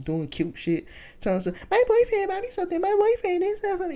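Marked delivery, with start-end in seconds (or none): 1.7-4.0 put-on high, fast, gushing girlfriend parody; mocking girly voice. Mimicking girls bragging about boyfriends, high gushing mock.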